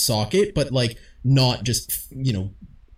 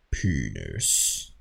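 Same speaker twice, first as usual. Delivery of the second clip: put-on much lower, quieter baritone kid voice; 7 dB quieter than usual. Host imitates the school kid who wins the penis game in a deep baritone. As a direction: drop deep and deadpan, like the kid in the back. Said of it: "baritone voice just going".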